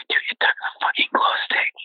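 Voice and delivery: hushed, retold panic to a friend; shocked whisper. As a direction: whisper it in disbelief.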